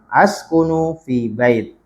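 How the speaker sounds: each syllable drawn out slowly for the class; slow model answer. Shown as a demonstration. Teacher models the answer slowly for students, slow and clear. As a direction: say askunu fi bait slowly, stressing askunu.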